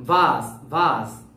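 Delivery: ভাস said as "vahs", clear V at the start; teacher models ভাস for pupils; pronunciation demo. Shown as a demonstration. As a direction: model ভাস with the lips on the teeth for V. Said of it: "এটার উচ্চারণ হচ্ছে কি?".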